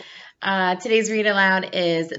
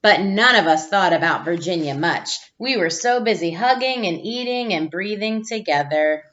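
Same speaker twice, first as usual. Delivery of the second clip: put-on gentle storybook read-aloud for young listeners; reading to children; 4 dB louder than usual. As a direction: read it like a teacher sharing a picture book with the class. Reads picture book to class.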